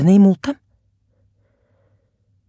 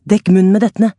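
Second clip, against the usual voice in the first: urgent order; 4 semitones higher, 6 dB louder than usual. A narrator's reading. Luan instructing in smoke.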